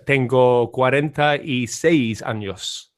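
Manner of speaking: slow, careful "Tengo cuarenta y seis años"; Spanish answer. Shown as a demonstration. Answering a friend's Spanish question, slowly. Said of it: "cuántos años tiene".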